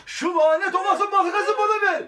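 high, loud melodramatic villain voice; hammy villain shout. Acted. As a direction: demand it like a cartoon villain. Repeated line in a villain voice. (abartılı kötü adam sesi)

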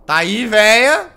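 loud, slow taunting call; teasing call. Real, not acted. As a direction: call out to her, drawn out. Calling out to the nun.

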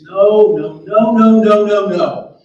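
very loud string of refusals; loud acted disbelief; emphatic denial. A narrator's reading. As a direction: belt out each no, refusing to believe it. Character denies seeing ghost again.

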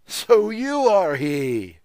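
high, slow, booming roar. Acted. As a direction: bellow with mocking triumph. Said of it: "he roared".

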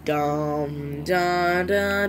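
ordinary talk

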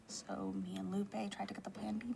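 hushed, anxious whisper. Real, not acted. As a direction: whisper it so nobody hears. Whispered confession of stress.

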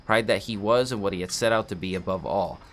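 narrating a tragic Russian novel; story narration. Acted. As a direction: read the novel aloud like an audiobook. Reads a book aloud.

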